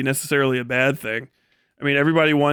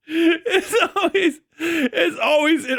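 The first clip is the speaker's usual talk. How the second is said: laughing; slower than usual. Real, not acted. Laughing hard at Gramulia.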